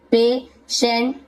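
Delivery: पेशेंट slowly split into "pay" and "shent"; syllable by syllable. Shown as a demonstration. Teacher recapping examples for learners, पेशेंट in slow separated parts. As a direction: say पेशेंट slowly with a gap inside. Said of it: "इस प्रकार से आप सेंट".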